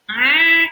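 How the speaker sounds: high, loud squawking ah; goose cry. Acted. Goose crying out to the giant, high squawk. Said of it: "cried the goose".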